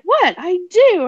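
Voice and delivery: very high, loud mock protest; short high yelp, then insist; defensive. Defending an odd liking to a friend, high-pitched and defensive. Defending his blurted confession.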